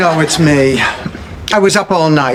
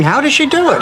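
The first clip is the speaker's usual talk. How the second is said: mock amazed onlookers; 8 semitones higher, faster than usual. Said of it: "Hopi Indians are walking around saying".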